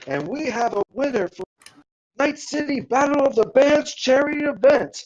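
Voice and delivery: high-pitched formal announcement to a crowd; event announcer. Acted. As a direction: build the suspense like an MC. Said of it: "You see the announcer come up".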